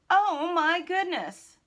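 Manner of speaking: high, slow cry of horror; loud alarm. A narrator's reading. Narrator sees the cart speeding away, loud drawn-out alarm.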